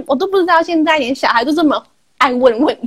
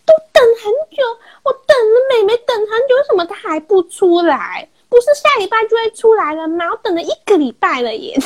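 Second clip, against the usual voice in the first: put-on higher, whiny crying kid voice; crying child; 9 semitones higher than usual. Boy crying to mom about baby sister, whining. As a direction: mimic a sobbing little boy. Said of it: "他就一直哭".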